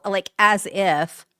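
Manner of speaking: put-on drawn-out, slow Valley girl "as if"; a light Valley girl drawl. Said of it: "at the risk of sounding like a Valley girl".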